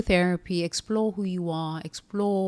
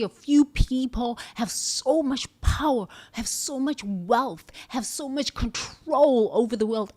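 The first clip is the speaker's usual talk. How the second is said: higher, louder indignant client voice; role-played rage; 5 semitones higher, 4 dB louder, slower than usual. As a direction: play the furious employee venting at billionaires.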